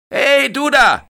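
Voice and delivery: very high, loud scolding call; scolding shout. Acted. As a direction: yell at the kid by the pool. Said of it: "schimpft er". (laut schimpfend gerufen)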